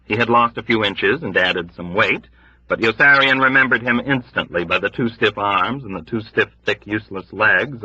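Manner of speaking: plain reading voice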